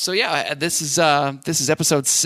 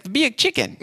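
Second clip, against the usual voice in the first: high-pitched, more convincing Southern lady; better Southern accent; 11 semitones higher than usual. Retold or imitated. Lady repeats the landmark to a baffled caller, Southern accent. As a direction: imitate a Southern woman, pitched way up. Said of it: "That was better".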